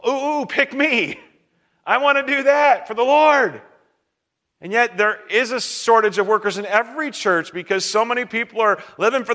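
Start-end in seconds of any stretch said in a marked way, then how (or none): 0.1-3.6 loud, high-pitched mock eagerness to serve; eager volunteer. Church member begging to serve the Lord, eager and high.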